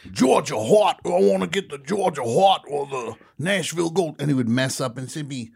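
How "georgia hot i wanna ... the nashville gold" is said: high-pitched, over-the-top Southern colonel; hammy fake Southern accent. Actor flubbing chicken ad lines as Colonel Sanders, fake Southern accent. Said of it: "most inauthentic Southern accent you've ever heard".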